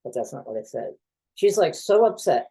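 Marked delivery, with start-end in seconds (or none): 0.0-0.9 quiet, even reply to his ex-wife; calm. Retold or imitated. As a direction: calmly set her straight, voice kept down. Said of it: "I'll just calmly say".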